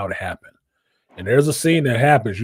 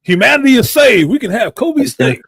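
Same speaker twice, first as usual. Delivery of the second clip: high and loud mock relief; louder and higher, hamming up the joy; mock triumphant; 13 semitones higher, 7 dB louder than usual. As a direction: crow it like a hammed-up hero.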